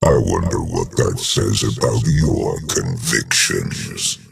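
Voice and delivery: usual speaking voice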